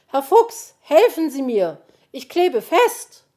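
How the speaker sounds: high, loud, distressed call; alarmed cry for help. A narrator's reading. Magpie stuck on the chimney begging fox, alarmed. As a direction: cry out in panic, stretching the last words.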